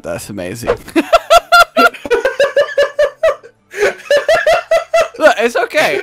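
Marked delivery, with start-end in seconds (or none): 0.8-3.3 high laugh. Real, not acted. Laughing at own joke.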